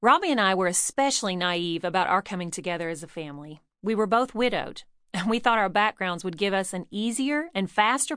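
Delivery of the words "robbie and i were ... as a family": ordinary delivery, nothing marked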